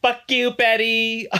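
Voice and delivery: high, drawn-out mock outrage; mock fury. Retelling Ethel cursing at Betty, mock fury. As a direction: stretch it out in hammed-up anger. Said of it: "Ethel gets so mad".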